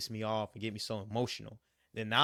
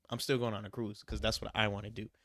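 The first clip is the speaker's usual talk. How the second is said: ordinary talk, nothing marked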